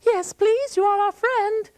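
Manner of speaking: put-on high, fast, boastful boy; a chatty youth brushing off the warning; Sasha. Sasha again.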